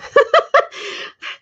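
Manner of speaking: high breathy laugh burst; a quick squealing laugh. Real, not acted. Laugh alone, high pitch.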